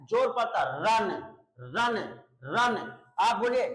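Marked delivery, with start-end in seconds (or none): 0.8-2.8 रन repeated with weight on its ending; stress on last syllable. Shown as a demonstration. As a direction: lean on the end of रन. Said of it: "अंतिम वाले syllable पे क्या पड़ता है".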